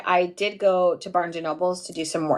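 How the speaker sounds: usual speaking voice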